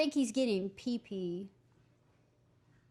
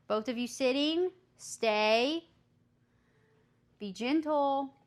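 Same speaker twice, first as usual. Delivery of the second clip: louder, higher commands; dog commands; 3 semitones higher, 5 dB louder than usual. Real, not acted. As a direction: give firm dog commands. Commanding the dogs.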